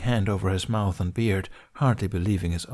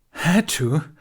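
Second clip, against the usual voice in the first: high, louder scornful scoff; 8 semitones higher, 6 dB louder than usual. Acted. Ben scoffing at Qui-Gon's excuse, higher and louder. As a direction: throw the words back with contempt. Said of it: "Ben scoffed".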